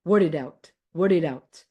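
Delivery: word it out repeated with the d run into it; linked as one word. Shown as a demonstration. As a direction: say word it out as wordidout. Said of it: "Instead of words, it's out".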